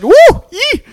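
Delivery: mock yelps at icy water; high cold-shock whoops. Imitates yelps in freezing water.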